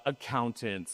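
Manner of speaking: low-pitched deadpan job title; deadpan mock caption. Presenter listing who was really interviewed in the gym, deadpan. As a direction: drop the pitch and list the job title drily.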